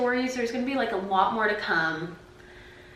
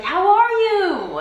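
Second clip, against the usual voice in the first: high, loud, drawn-out imitated greeting; overly familiar stranger; 10 semitones higher, 6 dB louder, slower than usual. Stranger chats her up in the store, overly familiar. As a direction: ask slowly, as if you're old friends. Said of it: "saying hi like he knows me".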